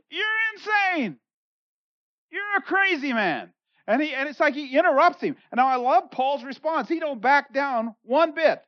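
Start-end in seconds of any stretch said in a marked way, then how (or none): 0.1-3.5 high, slow, scornful outburst; mock him as a madman; Festus interrupting. Festus cutting off Paul's sermon, high and scornful. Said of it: "Festus just stands up and he goes".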